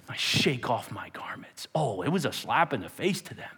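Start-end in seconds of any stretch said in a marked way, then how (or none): 0.1-1.7 low, slow, hushed declaration; quiet defiance. Acted. Paul facing the angry crowd, quietly defiant. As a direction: say it softly and deliberately to their faces.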